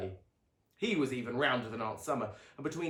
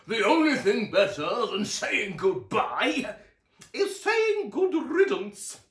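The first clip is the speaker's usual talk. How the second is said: much higher, louder, slow gloating aunt; Aunt Summer mocks the dead grandfather, slow high gloating; 10 semitones higher, 10 dB louder, slower than usual. A narrator's reading. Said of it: "added Aunt Summer".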